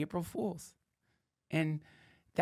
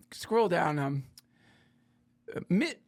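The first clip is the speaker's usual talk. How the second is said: ordinary talk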